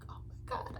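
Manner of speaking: breathless whisper of shock; barely voiced, stunned. Vlogger whispering her shock at the scene. Shocked reaction while recapping the show.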